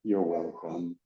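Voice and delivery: low, soft acknowledgement; understated reply. Retold or imitated. Ride host answers the first lady, low understated reply. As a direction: drop it low and small.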